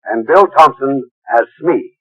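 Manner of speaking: ordinary talk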